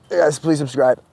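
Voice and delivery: ordinary delivery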